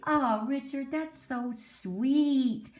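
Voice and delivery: put-on slow, drawn-out sweet old lady; cooing old lady. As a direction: coo it like a church lady charmed. Little old ladies coo.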